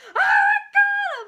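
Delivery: very high, loud imitated wail; screaming. Nancy being put on the gurney, screaming. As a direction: reenact her drawn-out shrieking scream. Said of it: "she starts screaming".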